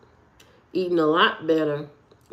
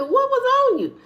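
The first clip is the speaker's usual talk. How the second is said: retold alarmed yell. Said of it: "I said".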